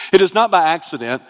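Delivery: usual speaking voice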